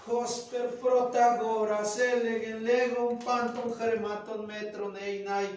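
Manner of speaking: slow, loud, declaiming the Greek line; Greek recitation. Shown as a demonstration. Teacher declaims Greek to show its beauty, slow and loud. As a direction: recite Protagoras like a classroom model. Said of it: "cuando vamos diciendo".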